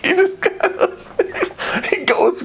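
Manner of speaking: high giggling through words; laughing. Real, not acted. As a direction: laugh as you try to speak. Laughing while telling the story.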